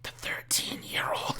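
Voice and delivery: harsh, hushed stage whisper; creepy whisper. Acted. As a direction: whisper it furtively, imitating the caller. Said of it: "he's probably whispering to himself".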